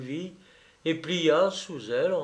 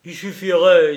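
louder, urging lawyer; lightly voiced Vinet; 3 semitones higher, 11 dB louder than usual. A narrator's reading. Vinet tells Sylvie how to win Provins, emphatic urging. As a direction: open the proposal with force.

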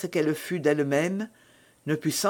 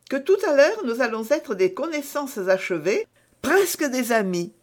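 higher-pitched, lively novice; eager exclamation; 7 semitones higher than usual. A narrator's reading. Novice promises Milady they will be near friends, eager exclamation. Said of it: "s'écria la novice".

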